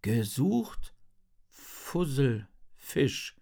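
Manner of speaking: slow, halting poster reading; Henry, reading aloud. A narrator's reading. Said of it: "liest er". (langsam und stockend vorgelesen)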